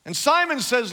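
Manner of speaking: ordinary talk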